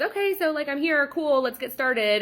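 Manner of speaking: mock ditzy client. Late client casually asks to start, mock high voice. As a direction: chirp it like nothing is wrong.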